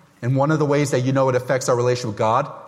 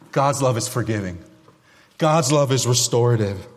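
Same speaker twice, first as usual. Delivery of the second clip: normal talking voice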